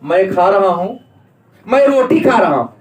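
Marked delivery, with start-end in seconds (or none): 1.7-2.7 raised, a touch louder, mock cross; mock angry. Shown as a demonstration. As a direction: snap it out like a cross eater. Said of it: "गुस्सा तो नहीं बोले". (नकली गुस्से में ज़ोर से)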